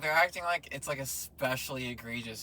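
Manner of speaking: loud exasperated protest. Real, not acted. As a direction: push it loud and heated. Heated argument.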